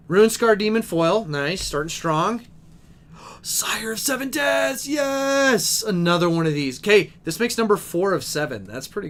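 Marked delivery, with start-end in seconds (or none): 3.1-5.8 high, thrilled gasp into a drawn-out yes; real delight at a lucky pull; high-pitched and elated, stretching the words; excited. Real, not acted. Pulls a hoped-for rare card.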